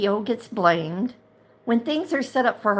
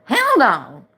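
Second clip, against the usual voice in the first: loud, firm "hell no"; real fed-up shout; angry refusal; 3 semitones higher, 6 dB louder than usual. Real, not acted. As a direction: slam the door on the idea. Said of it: "I'm steamed".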